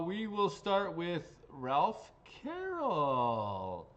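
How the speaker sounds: slow, high announcer call. Real, not acted. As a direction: stretch the name out like a raffle host. Calling out a winner's name.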